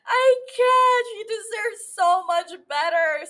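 high, upset wail; emotional. Real, not acted. Fan upset over Camille's ending, emotional. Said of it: "Why am I so emotional?".

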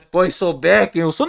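ordinary delivery

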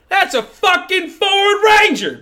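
very high, drawn-out holler; over-the-top imitation of a TikTok yeller; Ford Ranger guy. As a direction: exclaim it in a hammed-up country voice. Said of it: "the Ford Ranger guy from".

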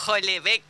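Houellebecq blunderingly as "jo-le-bek"; former mispronunciation. Shown as a demonstration. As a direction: read Houellebecq as if spelled in Spanish, j first. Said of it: "así a lo". (Houellebecq a lo bruto, "jolebek")

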